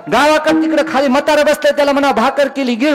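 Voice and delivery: high, insistent village voice; imitating old village woman. Old woman sends word to her husband about food, imitated high voice. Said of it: "ती म्हातारी".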